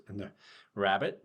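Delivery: usual speaking voice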